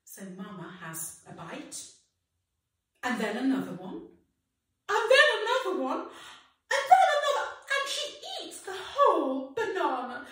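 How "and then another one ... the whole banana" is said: building excitement. Acted. Building excitement as Mama eats.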